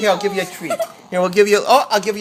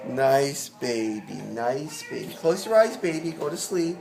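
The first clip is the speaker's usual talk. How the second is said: put-on soothing a child; 5 semitones lower, 3 dB quieter than usual. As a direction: gently lull the baby to sleep. Putting pretend baby to sleep.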